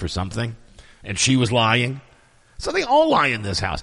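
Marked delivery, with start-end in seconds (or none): none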